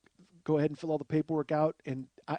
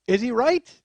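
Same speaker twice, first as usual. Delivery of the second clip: loud, raised suspense question; game show host reveal; 7 semitones higher, 9 dB louder than usual. Retold or imitated. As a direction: put the big question to the studio. Host builds suspense before reveal.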